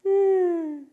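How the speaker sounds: put-on high, loud baby hum; toddler's wordless please. Toddler signing please before she can talk, high hum. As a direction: squeal a long "mm" in place of please. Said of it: "She couldn't say please".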